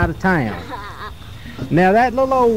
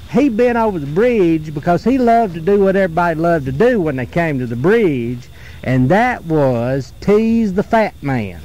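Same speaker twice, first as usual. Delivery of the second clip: plain reading voice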